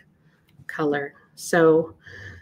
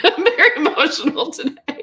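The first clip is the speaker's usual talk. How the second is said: teary laugh, higher and louder; laughing through emotion; 10 semitones higher, 6 dB louder than usual. Real, not acted. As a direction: laugh it off, moved. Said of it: "I'm gonna start crying".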